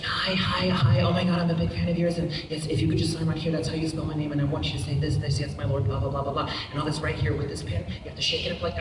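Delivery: lightly voiced small girl giving instructions; a hint of an eager ten-year-old fan; tiny little girl voice. Little girl instructing the actor how to sign, tiny voice. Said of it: "she's got this tiny little voice like".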